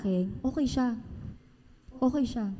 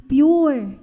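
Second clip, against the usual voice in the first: Pure shouted out, louder; loud emphatic pure; 2 semitones higher, 9 dB louder than usual. Shown as a demonstration. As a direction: belt the word Pure. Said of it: "Pure dapat".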